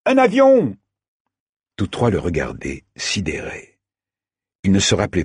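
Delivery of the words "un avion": very high, loud, amazed cry; excited exclamation. A narrator's reading. Saïd points out a plane to his friends, excited exclamation. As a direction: shout the sighting out, pitch leaping up. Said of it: "s'exclama Saïd".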